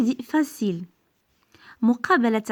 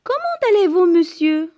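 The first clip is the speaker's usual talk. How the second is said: much higher and louder, polite rising question; question intonation; 6 semitones higher, 4 dB louder than usual. Shown as a demonstration. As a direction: greet him with a lifted questioning voice. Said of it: "Attention à l'intonation".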